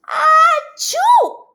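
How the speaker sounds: loud high achoo; big sneeze. Acted. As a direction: let a huge baby-dragon sneeze out. Baby dragon sneezes.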